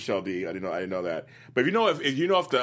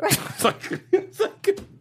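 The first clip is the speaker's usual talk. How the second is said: laughing; much slower than usual. Real, not acted. Laughing at Bill's line.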